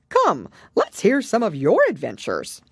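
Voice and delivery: a bossy creature urging her on; Gryphon. A narrator's reading. Gryphon voiced.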